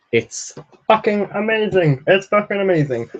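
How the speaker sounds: high, emphatic gushing; excited high-pitched praise; raving enthusiasm. Real, not acted. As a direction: rave about it with total conviction. Raving about a favourite novel.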